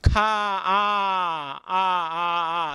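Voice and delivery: আ stretched out on one breath; drawn-out vowel. Shown as a demonstration. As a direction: hold the আ as long as breath lasts. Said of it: "আ আ করতে করতে ... টেনে নিয়ে". (আ ধ্বনি দম ধরে টেনে লম্বা করা)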